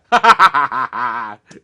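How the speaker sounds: big burst of real laughter; loud laugh. Real, not acted. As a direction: crack up at your own bad pun. Laughing at his own pun.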